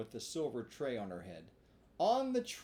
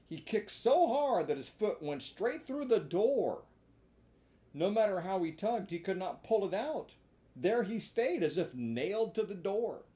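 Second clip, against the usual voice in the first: louder, building drama; excited narration; 7 semitones higher, 6 dB louder than usual. A narrator's reading. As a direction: tell it with rising excitement. Dramatic kicking scene narrated.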